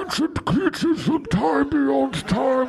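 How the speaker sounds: slow, high, portentous British intoning; Game of Thrones whisper-drama. Retold or imitated. Game of Thrones character warning, slow mock-ominous British. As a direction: murmur it like an ominous fantasy lord. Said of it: "where they're like".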